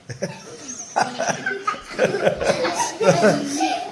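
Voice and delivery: long, high-pitched laugh. Real, not acted. Asked to show his teeth on camera, laughing. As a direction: burst into a drawn-out laugh.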